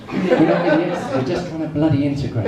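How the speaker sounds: half-laughing outburst; mock indignant rant. Real, not acted. Comic protests to Dutch listeners, indignant and laughing.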